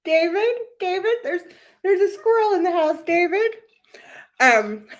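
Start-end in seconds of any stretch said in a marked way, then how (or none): 0.0-3.5 high, frantic mock call; panicked Shannon Beador impression. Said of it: "she's a little Shannon Beador".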